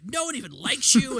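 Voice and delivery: high, loud mock outburst; flipping out. Retelling a classmate's rant in history class, mock flipping out. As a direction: imitate a classmate lashing out. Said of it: "she flipped out on me".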